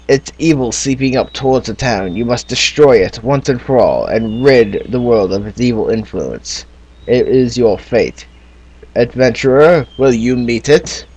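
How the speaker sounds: put-on storybook narration in a British accent. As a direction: read it like a British fantasy narrator. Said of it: "talking in a British accent".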